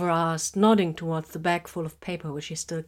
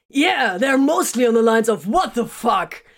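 loud, raised, slow shout; exasperated shout; 6 semitones higher, 8 dB louder than usual. A narrator's reading. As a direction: blurt it out exasperated. Soldier voices group shock.

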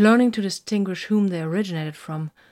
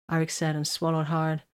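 ordinary delivery, nothing marked

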